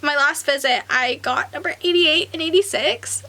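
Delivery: ordinary delivery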